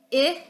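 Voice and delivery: "I" said as the short I of "it"; teacher modelling the short I; short I sound. Shown as a demonstration. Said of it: "short I".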